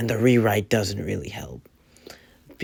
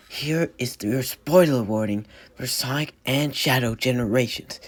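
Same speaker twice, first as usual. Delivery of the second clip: ordinary delivery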